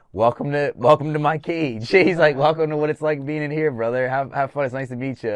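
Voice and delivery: loud, amused welcome; loud teasing coach voice; laughing coach. Retold or imitated. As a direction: tease the rookie warmly.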